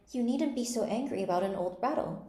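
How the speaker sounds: soothing. A narrator's reading. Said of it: "said in a soothing tone".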